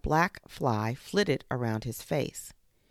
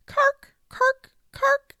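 very high, loud crowing bird call; piercing high morning bird call; 9 dB louder, much slower than usual. Acted. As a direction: call up the sun like a bushfowl. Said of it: "her familiar call".

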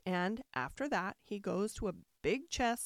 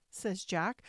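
ordinary delivery, nothing marked

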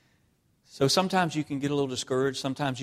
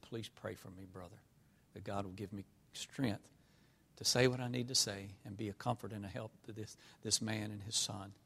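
very soft, near-silent plea for prayer; hushed plea; 4 semitones lower, 13 dB quieter than usual. Retold or imitated. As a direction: barely voice the brother's request, softer and softer. Brother asks prayer beside dead body.

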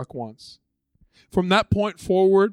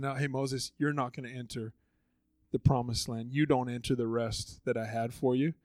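soft grave God; 4 semitones lower, 8 dB quieter than usual. Retold or imitated. God's grave verdict.

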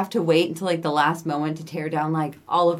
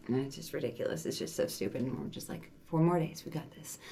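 much softer, half-whispered quotes; hushed mock mutter; 2 semitones lower, 11 dB quieter, faster than usual. Retelling the couple's weary pep talk, hushed. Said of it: "'cause he's like".

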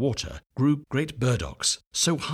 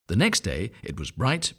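ordinary delivery

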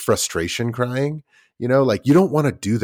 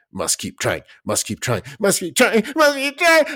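put-on kid building to meltdown. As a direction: push harder each time until it breaks. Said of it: "kids just, like".

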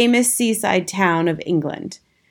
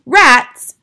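sharp, loud outraged cry; 7 semitones higher, 10 dB louder than usual. A narrator's reading. Hotel manager cries out at the word rats, loud outrage. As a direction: blurt it out in loud disbelief. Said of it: "cried Mister Stringer".

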